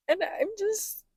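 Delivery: high, teary fake sobbing acceptance speech; hammed-up crying; mock tearful speech. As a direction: gush through fake tears at the Oscars.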